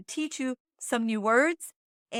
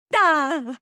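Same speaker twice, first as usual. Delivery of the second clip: loud mock order of "Pinta" to a husband; playfully bossy "Pinta" aimed at the husband; mock bossy command; 4 semitones higher, 7 dB louder than usual. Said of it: "look at him and go".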